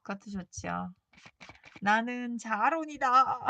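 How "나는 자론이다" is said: slow, loud shouted name; yelling your name out the window; mock shout. Shown as a demonstration. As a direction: belt out your own name slowly. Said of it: "막 이러면서 소리 지를까요".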